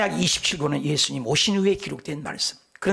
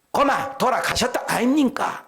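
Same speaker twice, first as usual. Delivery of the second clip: Gyeongsang dialect; 8 semitones higher, 3 dB louder than usual. Retold or imitated. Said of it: "경상도 사람은".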